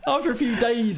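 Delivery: high and laughing; mock the phrase through laughter; amused disbelief; laughing mock emphasis. Real, not acted. Mocking the article's wording.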